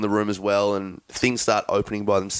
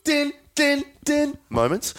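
high hummed dun-dun-dun; mock horror music sting; slower than usual. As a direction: sing it like a creepy film score. Mimics suspense film music.

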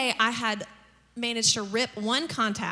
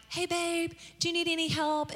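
lightly voiced husband, higher pitch; higher, easygoing imitation of a spouse; husband's greeting; 4 semitones higher, 3 dB quieter than usual. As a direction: imitate a breezy husband offering help. Imitating husband arriving home.

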